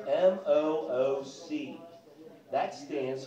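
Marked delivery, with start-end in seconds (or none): 0.1-1.8 slow, loud letter by letter; spelled out letters. Shown as a demonstration. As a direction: spell each letter out slowly. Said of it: "I spell moose".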